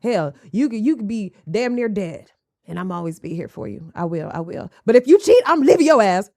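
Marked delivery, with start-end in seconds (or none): 4.8-6.3 shouted, much louder warning; loud sassy shout of a warning. Acted. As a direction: yell the threat. Warning about cheating.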